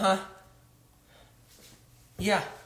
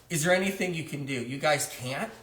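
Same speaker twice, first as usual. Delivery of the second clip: usual speaking voice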